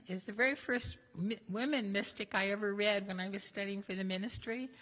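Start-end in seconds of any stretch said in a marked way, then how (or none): none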